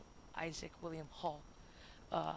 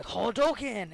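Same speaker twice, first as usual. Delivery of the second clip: louder, higher mock battle cry; playful Hadouken yell; game attack cry; 4 semitones higher, 10 dB louder, much slower than usual. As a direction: call it out like a video-game special move. Acts out video-game attack cry.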